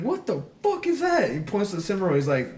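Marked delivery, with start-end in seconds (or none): 0.0-1.3 high-pitched incredulous outrage; retold outraged disbelief; pissed-off drill sergeant. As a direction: imitate a sergeant demanding an explanation. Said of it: "he got so pissed".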